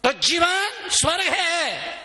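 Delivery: high, slow, drawn-out declaration; emphatic proclamation. Real, not acted. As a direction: stretch out the promise for the crowd. Preacher proclaims the payoff. (ऊँचे स्वर में खींचकर घोषणा)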